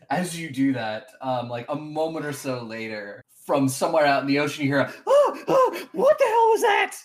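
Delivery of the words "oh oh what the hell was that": very high, loud alarmed cry from afar; startled stranger. Acted. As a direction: yelp out in shock from the water. GM voices startled figure in ocean.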